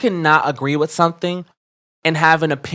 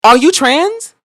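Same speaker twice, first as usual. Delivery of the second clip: much higher and louder, building outrage; heated indignation; 9 semitones higher, 5 dB louder than usual. Real, not acted. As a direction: challenge him fiercely. Heated rant at commenter.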